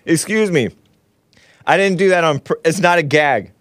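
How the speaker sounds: strained voice after choking; clearing up after choking. Real, not acted. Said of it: "I, I'm choking".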